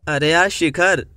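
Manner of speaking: ordinary talk, nothing marked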